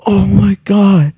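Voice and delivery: devastated gamer's loud lament; distraught. Real, not acted. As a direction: cry it out slowly in disbelief. Reacts right after losing.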